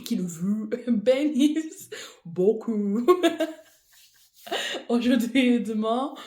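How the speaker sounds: slow retry of bénisse beaucoup, laughing mid-phrase. Real, not acted. Blessing viewers again, laughing at the stumble, slowly. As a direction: giggle through the second try, slowly. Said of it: "ça ne marche pas".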